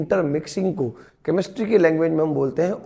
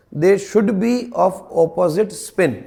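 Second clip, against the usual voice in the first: slow dictation; much slower than usual. Shown as a demonstration. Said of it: "dictating a rule".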